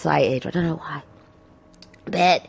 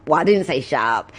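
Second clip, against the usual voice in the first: usual speaking voice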